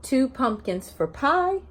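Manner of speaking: slow, lilting picture-book read-aloud; sing-song story reading to a class; reading to children. Acted. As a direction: read each page like story time for little ones.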